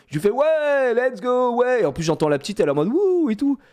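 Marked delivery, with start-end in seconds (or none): 0.3-1.8 high-pitched cheering whoop; drawn-out, high hype shout; hyping him up. Retold or imitated. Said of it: "je le hype un peu". 2.9-3.3 high gleeful "wouh"; high, louder mock cheer; little girl's whoop. Imitates the little girl whooping.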